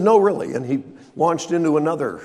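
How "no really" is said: insistent protest. Retold or imitated. Man insisting he is unworthy.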